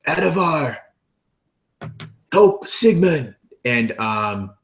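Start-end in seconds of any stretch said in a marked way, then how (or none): 0.1-3.3 higher-pitched, drawn-out call for help; Elidor yelling. Acted. Elidor calls to Adavar to help Sigmund, yelling in character. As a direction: call out urgently to an ally, slowly. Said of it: "he does yell to Adavar".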